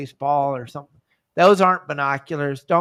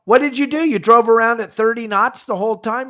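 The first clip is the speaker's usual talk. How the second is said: much higher and louder, mock disbelief; incredulous retold question; 7 semitones higher, 6 dB louder than usual. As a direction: act out grilling the member in amazement. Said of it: "I go".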